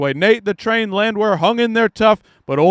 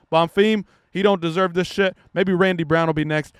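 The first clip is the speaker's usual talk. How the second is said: ordinary talk, nothing marked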